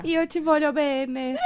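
slow, soft soap-opera Italian; Terra Nostra Italian. Acted. Co-host playing at soap-opera Italian, Terra Nostra Italian accent. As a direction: draw out the Italian softly, like a telenovela line. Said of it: "falar o italiano da Terra Nostra".

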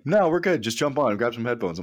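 high, breezy mock buddy voice; laid-back buddy. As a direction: toss it off like it's no big deal. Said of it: "he's like".

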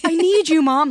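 light imitation of kids calling Mom. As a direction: beg for Mom like a needy child.

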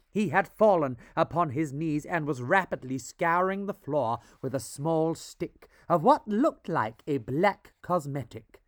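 ordinary delivery

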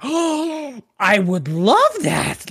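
high "oh", then a slow, thrilled answer; delighted elf. Retold or imitated. Costumed elf accepts the photo offer, slow and delighted. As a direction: gush with childlike delight.

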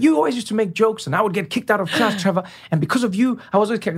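high, put-on voice of complaint; animated mock grievance; imitated accusing schoolmate.

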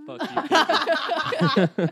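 slow laughing high drawl; stretched out through laughter. Pretend. Mock dramatic repeat, laughing.

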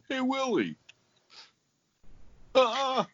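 high squeaky elf voice again; christmas elf. Retold or imitated. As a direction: pitch way up like a cartoon elf. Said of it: "He'd always go".

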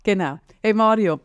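usual speaking voice

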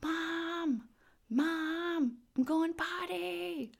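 put-on high, drawn-out little girl calling out from the bathroom; high, slow sing-song child's call; imitating a yelling toddler. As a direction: call for mom like a three-year-old who just went potty. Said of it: "she yells for me".

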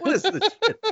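high, mock-indignant ribbing; hammed-up disbelief; mock outrage. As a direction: play up fake outrage, pitched high. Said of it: "You know, like".

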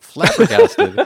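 "flabbergasted" drawn out slowly, loud and high through laughter; laughing. Real, not acted. Telling the host he learned the ocean is salty, laughing loud and slow. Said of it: "wiping tears from his eyes".

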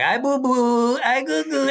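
put-on high cooing baby talk. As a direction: coo it like talking to a baby. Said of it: "I do baby talk".

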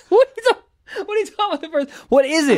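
high squealing laugh through words; laughing hard. Real, not acted. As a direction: crack up while asking. Friend asks first letter.

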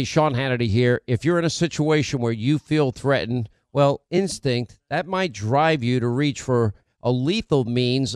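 upbeat radio ad voice; ad read. Acted. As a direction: sell it like a sponsor spot. Pre-recorded ad reads.

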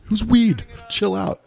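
high-pitched mock exasperated buddy; dismissive friend. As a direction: imitate a pal brushing off the panic. Friends dismissing his panic.

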